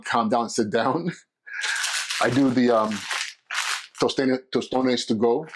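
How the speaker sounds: ordinary delivery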